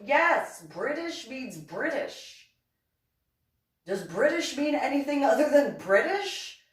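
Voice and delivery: high, incredulous mock outburst; exasperated retort. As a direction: hit it like it's painfully obvious. Retold exasperated retort about British computer.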